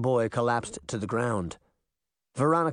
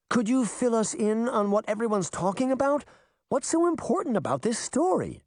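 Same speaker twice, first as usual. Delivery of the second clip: put-on young girl voice; 9 semitones higher than usual. A narrator's reading. Sabrina voiced in story.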